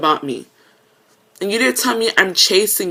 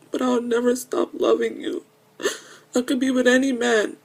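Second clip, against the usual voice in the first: softly crying while pledging love; real tearful voice; 4 semitones higher, 4 dB quieter than usual. Real, not acted. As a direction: pledge love quietly through tears. Tearful plea to lost love.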